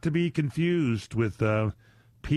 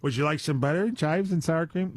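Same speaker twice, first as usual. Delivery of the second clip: mock waiter; 5 semitones higher than usual. Mock waiter offering toppings.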